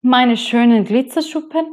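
as if the idea were outrageous; appalled. Acted. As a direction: rainbow fish aghast at giving scales away. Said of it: "dachte der Regenbogenfisch entsetzt".

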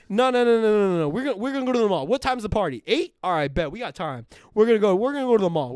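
high, loud hyped-up dad; a dad hammed up and thrilled; excited dad. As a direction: rush to fix his outfit. Dad voiced in a TikTok.

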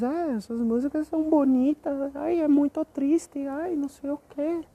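high, whiny imitation of sentimental fans; mock their gushing; teasing what people say about the songs. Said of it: "E aí o povo diz".

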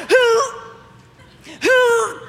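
high-pitched heave of effort; hammed-up lifting noise, twice over; mock straining grunt. Acting out heaving at the grating.

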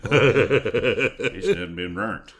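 slow, loud, laughing through words. Real, not acted. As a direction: drag it out while cracking up. Laughing at the punchline.

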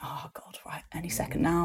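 unvoiced anxious whisper; nervous whisper. Nervous fan whispering as everyone packs up. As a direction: breathe out the nerves in a whisper. Nervous self-talk at event end.

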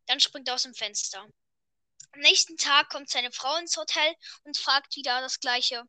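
ordinary talk, nothing marked

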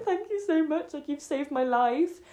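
high-pitched gushing thanks, slowing at the end; mock tearful gratitude. Drunk woman thanking girls who helped, mock tearful gratitude.

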